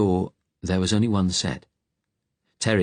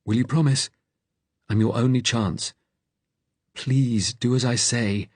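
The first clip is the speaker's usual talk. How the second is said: higher, earnest appeal; urgent plea, voice raised in pitch; pleading; 6 semitones higher than usual. A narrator's reading. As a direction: beg him to do as you say. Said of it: "his tone suddenly pleading".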